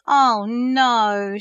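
a loud, high dismayed wail over the missed bus. A narrator's reading. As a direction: cry out in dismay as the bus leaves. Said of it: "she wailed".

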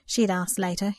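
usual speaking voice

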